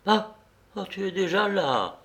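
high, astonished falter with a touch of fright; startled stammer. A narrator's reading. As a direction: gasp out surprise, slightly afraid. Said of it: "balbutia-t-il avec un étonnement mêlé de frayeur".

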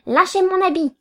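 high, irritated command; sharp order. A narrator's reading. Lawyer to man clutching his coat, sharp order. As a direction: order him off, pitched well up.

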